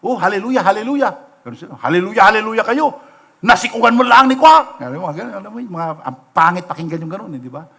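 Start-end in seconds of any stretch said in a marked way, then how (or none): 0.0-1.1 light sarcastic "hallelujah"; mocking hallelujah. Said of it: "pangutya nung kuya ko". 1.8-4.8 louder, fast, scornful "hallelujah kayo"; sneering mockery. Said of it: "pangutya nung kuya ko".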